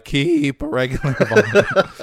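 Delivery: high, loud, cracking voice; strained and squeaky, voice going; losing the voice. Shown as a demonstration. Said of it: "what's going on with my voice. I'm losing it".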